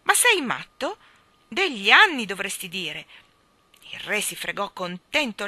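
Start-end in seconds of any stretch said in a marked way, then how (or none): 0.0-3.1 loud, high mocking retort; king scoffing at the cat. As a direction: laugh him off as a fool.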